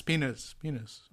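very soft echo trailing off; fading echo. Acted. Host echoing his last quiz option, fading echo.

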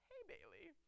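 high but faint "Hey, Bailey"; a tiny voice barely getting through; small inner voice. Retold or imitated. Cautious part of her brain trying to warn her, small voice. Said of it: "drowned out".

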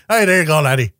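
a lightly done bad Scottish accent as Scotty; mock Scottish brogue, only a hint of it; half-hearted Scottish accent, Scotty bragging about his fix; shitty Scottish accent. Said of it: "my shitty Scottish accent".

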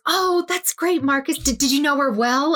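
a hint of over-keen chatter; eager Emily. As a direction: gush up at him, keen to engage. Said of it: "Emily goes".

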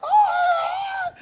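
very high, loud wail; imitated crying scream. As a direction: wail like a pinned-down kid. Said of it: "my sister would cry and scream".

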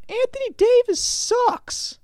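put-on very high, loud, drawn-out kid yell; kid taunting. Kid taunts the man in the Lakers hat, high slow yell. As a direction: jeer it like a cheeky kid.

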